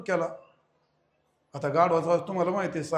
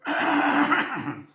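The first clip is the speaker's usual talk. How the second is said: mock donkey bray; 10 semitones higher, 4 dB louder than usual. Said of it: "गाढव म्हणला".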